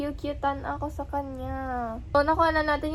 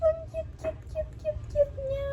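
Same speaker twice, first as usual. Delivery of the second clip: high, softer squeak of adoration; gushing squeal; 12 semitones higher, 4 dB quieter than usual. Real, not acted. Vlogger adoring the lion, gushing high squeal. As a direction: coo rapidly repeated words way up high.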